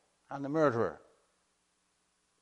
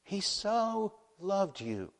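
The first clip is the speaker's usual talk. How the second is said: tender turn; 9 semitones higher, slower than usual. Acted. Turn from wrath to love.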